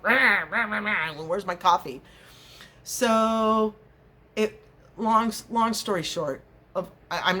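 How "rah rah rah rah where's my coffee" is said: mock grumpy growling before coffee. To husband, no morning coffee yet: playful grumpy growl. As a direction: growl it out like a cranky bear with no coffee. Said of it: "with a mad face".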